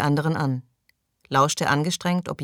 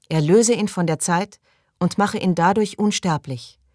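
raised, higher-pitched invocation; prayer leader; 5 semitones higher, 4 dB louder than usual. Acted. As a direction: call out the prayer for others to repeat. Leader recites prayer, others repeat. (feierlich vorbetend, höher)

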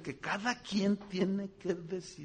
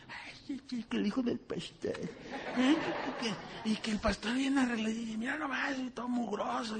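mock gossip; 6 semitones higher than usual. Mocking church gossips.

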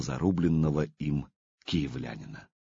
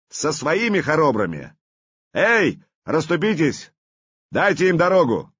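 commanding proclamation; 6 semitones higher, 4 dB louder than usual. Acted. Prince releases enemies.

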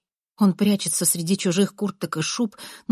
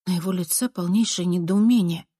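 usual speaking voice

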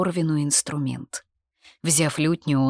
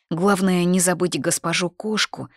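usual speaking voice

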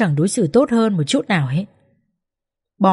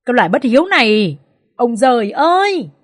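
anguished wail; 5 semitones higher than usual. Acted. Mother-in-law wails at son.

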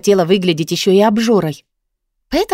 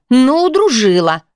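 mildly indignant, drawn out and higher; slow, higher-pitched reproach; reproachful; 6 semitones higher, 4 dB louder than usual. A narrator's reading. Friend hands over a complaint, reproachful. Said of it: "выдала с претензией".

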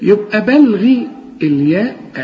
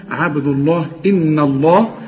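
usual speaking voice

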